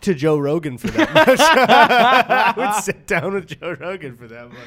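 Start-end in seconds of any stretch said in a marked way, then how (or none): 1.4-4.6 laughing through the line, louder and slower; cracking up mid-sentence. Real, not acted. To his co-host, joking about Joe Rogan, laughing.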